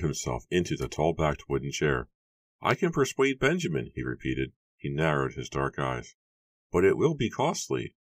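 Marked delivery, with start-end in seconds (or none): 2.6-3.9 high, assured character voice; Matthew's character voice. A narrator's reading. Matthew repeats his claim, high voice. 6.7-7.9 high-pitched, insinuating bargainer; name the catch in a raised register; Matthew's character voice. A narrator's reading. Matthew names a price, high voice.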